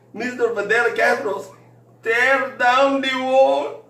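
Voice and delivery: put-on high, slow, loud Mexican accent, drawn out; mock crying accent. Crying drunk boss pleads about the wall, high and slow in an accent. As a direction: wail it like a drunk lament in an accent. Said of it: "and he's crying".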